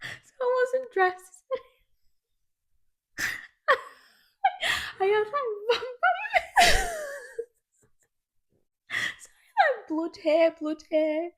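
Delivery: high, laughing, slow at first. Real, not acted. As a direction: giggle through the memory.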